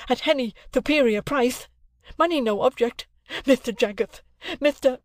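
very high, frantic lisping plea; excitable lisping suppliant. Acted. Excitable suppliant begs Jaggers for a moment, frantic. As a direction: beg desperately in a high, lisping voice.

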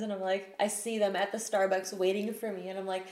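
ordinary talk

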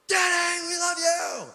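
put-on high, loud holler of children waking dad; imitating shouting kids; excited kids. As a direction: yell it like kids bursting in at dawn. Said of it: "Like six A M in the morning".

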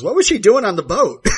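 loud, high bellowed question; bellowing. Retold or imitated. Co-host retelling Roman blurting the secret, loud bellow. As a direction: bellow it across the room. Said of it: "Roman practically bellows".

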